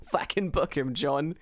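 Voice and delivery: normal talking voice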